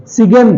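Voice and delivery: सिगन with short i and hard g; wrong pronunciation. Shown as a demonstration. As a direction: sound the g in सिगन. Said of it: "नहीं".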